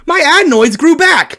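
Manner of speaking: high, loud outraged reveal; exasperated yell; indignant shout. Acted. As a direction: shout it in disbelief. Outraged announcement of adenoids returning.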